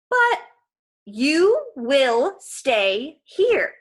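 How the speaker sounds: loud, very slow, drawn-out order; stern command. A narrator's reading. Missus Dodds ordering Grover to stay, slow loud command. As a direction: lay down the law word by word.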